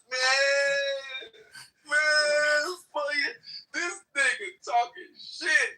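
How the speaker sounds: mock crying yell. Mocking the rival as a crying, yelling seven-year-old. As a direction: imitate a kid crying and yelling at once. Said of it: "He sound like he crying and yelling".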